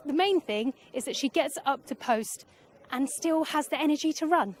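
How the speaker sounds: ordinary delivery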